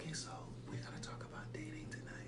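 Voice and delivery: mock-quiet whisper, barely audible; exaggerated hush to tease a complainer; too low. Host answering complaints about yelling, in a whisper. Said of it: "now you talking too low".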